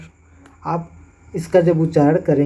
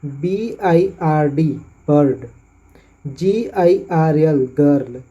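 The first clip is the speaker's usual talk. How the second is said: slow spelling, then bird and girl with 'ar'; slow spelling demo; slower than usual. Shown as a demonstration. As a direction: spell carefully for the class. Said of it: "अगला है जहाँ पे IR आते हैं". (धीमे bird girl)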